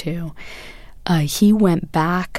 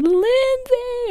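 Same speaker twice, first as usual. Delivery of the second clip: high, excited squeal of a name. Retold or imitated. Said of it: "she started squealing".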